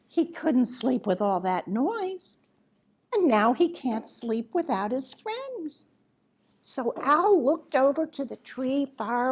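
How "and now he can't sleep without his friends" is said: plain reading voice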